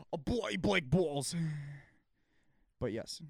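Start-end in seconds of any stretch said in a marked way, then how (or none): 0.1-1.3 slow, hammed-up London accent; London accent, bloody drawn out; ridiculous London accent. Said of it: "talk in a ridiculous London accent".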